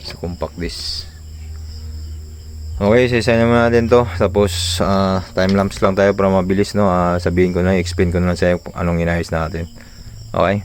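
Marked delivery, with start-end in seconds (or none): none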